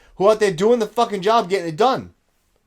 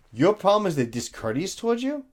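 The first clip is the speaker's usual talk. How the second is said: ordinary talk, nothing marked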